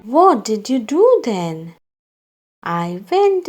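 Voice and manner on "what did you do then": usual speaking voice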